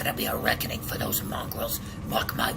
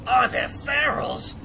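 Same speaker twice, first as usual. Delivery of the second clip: higher, louder and slower, hammering the question; "Are there ferals?" cried out high and loud, each word dragged; emphatic repeat; 11 semitones higher, 7 dB louder, slower than usual. Acted. Sewer dweller warning the newcomer away, repeating it loud and high.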